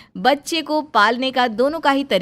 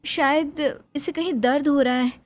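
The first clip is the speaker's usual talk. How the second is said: lightly voiced young wife, higher and anxious; worried young bahu; 6 semitones higher than usual. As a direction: plead gently with your mother-in-law. Bahu Reena, worried, to mother-in-law. (हल्की ऊँची आवाज़ में परेशान बहू)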